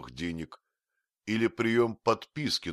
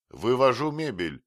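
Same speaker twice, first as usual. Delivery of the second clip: loud, raised, decisive announcement; determined official pronouncement; resolute; 7 semitones higher, 6 dB louder than usual. A narrator's reading. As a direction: declare it with no room for argument. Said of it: "решительно заявил Бендер".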